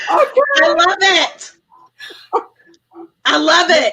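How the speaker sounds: a drawn-out, beaming I love it; delighted. Real, not acted. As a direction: relish every word with real delight. Delighted reaction to the punchline.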